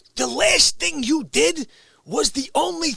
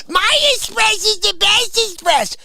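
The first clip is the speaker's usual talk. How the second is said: put-on loud, high, childish boast; mock bragging; 10 semitones higher, 5 dB louder than usual. Mocking the rude customer, loud and high, bragging. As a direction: ham up a bratty brag. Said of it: "You go".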